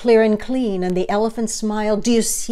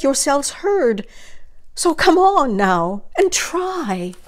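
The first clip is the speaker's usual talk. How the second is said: calling out pleading; 6 semitones higher than usual. Acted. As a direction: call up to them, urging, voice cracking by the end. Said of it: "he managed to call to the mayor".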